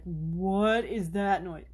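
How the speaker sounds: slow puzzled voice of a startled cat; cat's voice. Retold or imitated. Owner voicing her frightened cat, slow puzzled voice. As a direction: voice the freaked-out cat, slowly. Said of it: "She was like".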